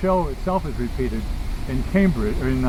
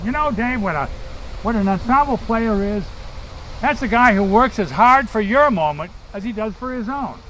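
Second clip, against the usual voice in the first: fond, raised Boston accent impression of an old actor friend; 8 semitones higher than usual. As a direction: lift the pitch and lean into the Boston accent. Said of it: "inimitable Boston accent".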